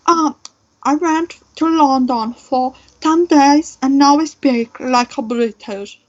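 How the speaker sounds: a pretentious fake British accent, hammed up; mock fake British accent. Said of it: "fake British accent".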